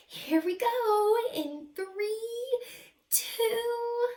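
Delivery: high, slow thrilled countdown; excited countdown. Acted. Amelia counting down on the roller coaster, high and thrilled. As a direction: count it down like the ride is starting.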